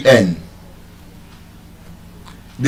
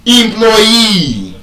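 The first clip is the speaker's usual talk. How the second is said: higher "Employee" as im-ploy-EE, long ending; "Employee" opening on im, landing on EE; stress on last syllable; 6 semitones higher than usual. Shown as a demonstration. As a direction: stretch the final EE of "Employee". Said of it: "I just pronounced it the right way".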